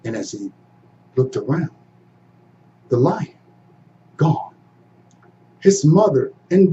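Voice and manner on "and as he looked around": plain reading voice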